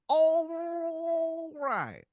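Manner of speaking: a cartoon Philly guy bragging about his snacks; awful Philadelphia accent. Wizard driver offering hoagies in a terrible Philadelphia accent. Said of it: "his accent is just awful".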